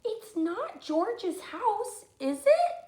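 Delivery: put-on high, slow questioning girl; a little girl checking with her friend; doubtful Lindy. Lindy asking Marley, high and slow.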